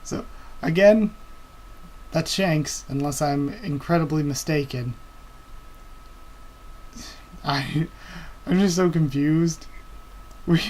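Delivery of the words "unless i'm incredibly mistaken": ordinary delivery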